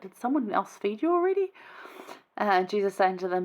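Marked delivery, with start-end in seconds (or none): 0.0-1.5 confused disciples. Retold or imitated.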